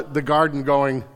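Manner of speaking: ordinary delivery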